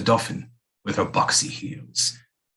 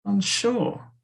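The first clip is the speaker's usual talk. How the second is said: incredulous; 11 semitones higher than usual. Acted. Incredulous echo of queen's words.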